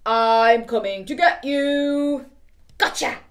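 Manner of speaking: slow, loud monster voice; spooky chaser. Acted. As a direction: stretch it out like a monster creeping closer.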